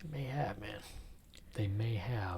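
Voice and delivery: eerie whisper; spooky conspiratorial whisper. Acted. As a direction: hush it like a secret. Spooky agreement about covid.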